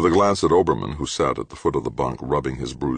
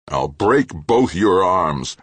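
louder, slow, menacing snarl; 5 semitones higher, 5 dB louder, much slower than usual. A narrator's reading. Grant snarling a threat at Halleck. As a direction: threaten through your teeth. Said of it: "Grant snarled".

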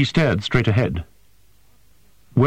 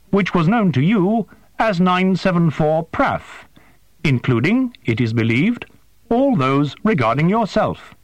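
high, precise voice of a small winged creature; formal, formal alien creature, pitched high; 8 semitones higher than usual. A narrator's reading. Creature Prath speaks.

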